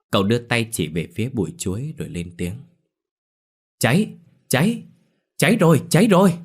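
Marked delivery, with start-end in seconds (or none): 3.8-6.4 high, loud alarm call of fire; shouting fire. A narrator's reading. Sang shouts to Lâm and Lũ that there is a fire, high alarmed yelling. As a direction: yell it out to raise the alarm. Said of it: "nghe Sang hô cháy".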